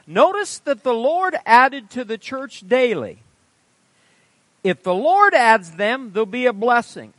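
slow, loud and weighty, every phrase driven home; emphatic sermon reading. Acted. Preacher warning the congregation about church rolls, slow and emphatic. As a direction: preach the warning slowly, pressing each clause.

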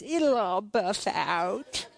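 slow, drawn-out tease with a grin; grinning wife. Retold or imitated. Wife teasing husband about his scratched truck, slow grinning imitation. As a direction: imitate a wife teasing, stretching each word. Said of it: "she just gives me this grin and says".

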